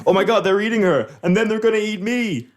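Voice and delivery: high, hammed-up horror cry building to a long wail; mock-horrified line ending on a drawn-out, loud "God"; infamous film-scene impression. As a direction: mimic a terrible actor's big panic moment. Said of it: "Oh my God" scene, the, the infamous".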